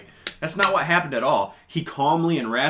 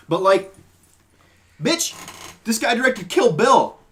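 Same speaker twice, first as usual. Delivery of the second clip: much higher and louder, indignant; high, loud exasperated tirade; heated rant; 7 semitones higher, 5 dB louder than usual. Real, not acted. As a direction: rattle off his films in outrage. Ranting defence of Tarantino.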